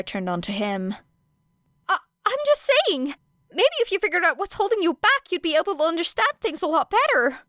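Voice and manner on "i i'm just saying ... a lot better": put-on higher, faster nervous boy voice, a little unsure; hesitant Midoriya. A narrator's reading. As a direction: rush it out, worried he's overstepping. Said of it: "said Midoriya hesitantly".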